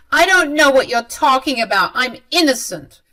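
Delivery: acted affronted mother; defensive denial. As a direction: insist on your innocence indignantly. Mother loudly denies the accusation.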